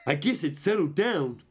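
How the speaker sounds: a lightly voiced calm Pokemon musing; light character voice. Streamer reading Trisquirtle's line, lightly voiced. Reads Trisquirtle's game dialogue.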